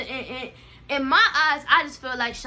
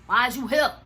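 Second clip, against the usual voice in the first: louder; 6 dB louder than usual. Retold or imitated. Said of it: "repeated escalating".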